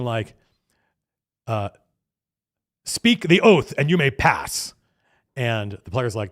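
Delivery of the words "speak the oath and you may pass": slow and louder, weighty demand; solemn guardian voice. Retold or imitated. Triumvirate demanding the oath from the players, slow and solemn. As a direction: intone it like a gatekeeper.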